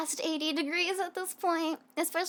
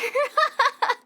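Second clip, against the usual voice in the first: high giggle; 9 semitones higher, 5 dB louder than usual. Real, not acted. Laughing at own joke.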